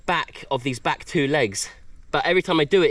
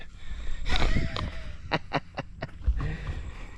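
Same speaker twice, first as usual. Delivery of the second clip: breathless laugh; 7 dB quieter than usual. Real, not acted. Breathless laugh after catch.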